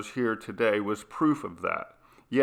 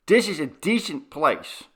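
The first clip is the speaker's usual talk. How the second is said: usual speaking voice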